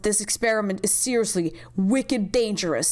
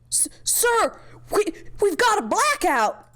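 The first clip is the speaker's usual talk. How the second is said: high stammering panic; stammering high alarmed shout; panicked report; 11 semitones higher than usual. Acted. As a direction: blurt out the blackout in panic.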